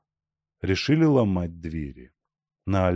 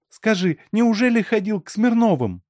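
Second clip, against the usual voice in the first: high, louder woman's demand; anxious questioning; 13 semitones higher, 5 dB louder than usual. A narrator's reading. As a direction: press him with a high-pitched question. Anxious woman questioning him at night. (высокий тревожный женский голос)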